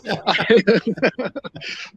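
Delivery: long, high-pitched chuckling; real laugh. Real, not acted. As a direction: keep chuckling at your own foolishness. Laughing at own reckless bravado.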